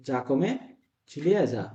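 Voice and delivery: ordinary delivery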